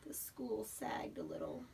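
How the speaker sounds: soft sorrowful whisper; sad whisper. A narrator's reading. Mother telling child the school was sad. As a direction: let it sink, sadly. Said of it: "sad school".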